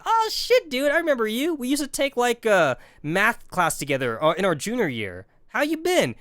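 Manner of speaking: put-on light up on recognizing an old classmate; surprised greeting. Greeting the valedictorian, high surprised delight.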